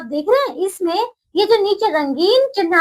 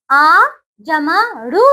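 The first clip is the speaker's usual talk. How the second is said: slow syllable spelling; much slower than usual. Shown as a demonstration. Teacher to children spelling out a word, slow and deliberate. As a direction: sound out आ, जमा, रू one by one, slowly.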